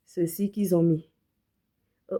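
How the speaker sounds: ordinary talk, nothing marked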